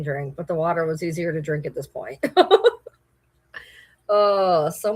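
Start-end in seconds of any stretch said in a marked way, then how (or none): 2.2-2.9 high, loud chuckle; real burst of amused laughter; laughing. Real, not acted. Amused at own drink choice.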